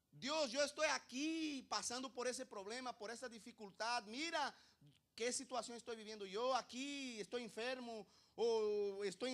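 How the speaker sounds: loud, high mock-desperate complaint; acted shouted plea, stretched words; crying out to God. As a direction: wail your list of troubles at heaven. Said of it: "Y estamos gritando, gritando, gritando".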